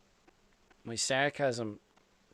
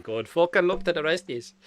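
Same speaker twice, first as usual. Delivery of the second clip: a hint of broad Irish, a bit higher and louder; mock Irish; 6 semitones higher, 5 dB louder than usual. Irish guy trying to follow Aussie talk, lightly Irish. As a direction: throw on a light Irish twang. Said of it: "if I'm from Ireland".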